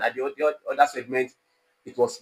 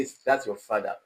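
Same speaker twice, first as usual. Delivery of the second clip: "Is that your father" lowered to the end; falling tone; 3 semitones lower than usual. Shown as a demonstration. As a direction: drop 'father' as if stating it. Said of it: "It's not falling tone".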